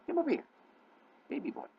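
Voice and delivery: very high-pitched call to a cat; pet coaxing. Real, not acted. Owner calling a cat to come over, high pet-talk coaxing.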